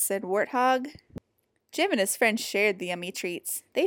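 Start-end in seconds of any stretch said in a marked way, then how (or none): none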